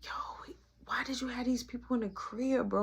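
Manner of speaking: a hushed "why'd you let them in"; hushed accusing whisper. Retold or imitated. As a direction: whisper the question, tense and secretive. Confronting friend in hushed tone.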